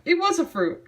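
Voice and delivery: ordinary delivery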